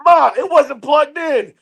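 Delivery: high, loud, then slow sheepish admission; sheepish son. Retold or imitated. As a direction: admit the mistake to mom. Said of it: "he goes".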